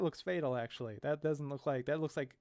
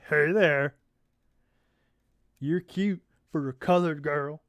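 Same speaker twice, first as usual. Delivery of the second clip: sleazy thug. Acted. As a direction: creep her out on purpose. Thug harassing Amber.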